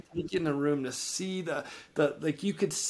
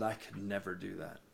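quiet refusal; 7 semitones lower, 11 dB quieter than usual. Retold or imitated. Tyrone refuses to forgive his dad.

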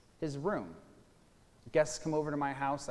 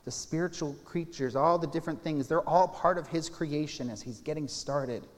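normal talking voice

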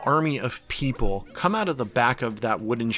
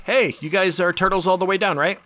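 bright, eager camp host voice, pitched up; friendly host waving you in; cheerful camp host; 4 semitones higher than usual. Acted. GM voices Random.

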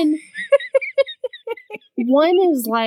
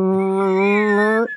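steady electronic hum; cauldron powering up hum. Shown as a demonstration. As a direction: hum like a machine starting. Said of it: "This is a cauldron powering up".

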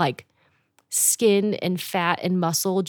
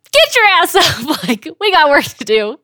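high, loud shouted order; retold urgent shout; 10 semitones higher, 9 dB louder than usual. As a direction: yell to rouse someone from sleep. Retold shouting at girl to get up.

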